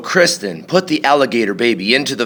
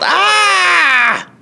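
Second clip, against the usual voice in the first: very high shocked scream; father's yell; 3 dB louder than usual. A narrator's reading. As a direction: screech at the flapping flipper. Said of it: "He yelled".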